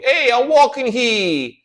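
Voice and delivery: put-on loud, slow, high New York accent, stretching "walking"; New Yorker accent. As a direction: yell it at strangers like a fake New Yorker. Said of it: "starts walking around at people and going".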